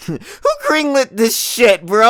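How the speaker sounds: laughing through the words. Real, not acted. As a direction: laugh while asking.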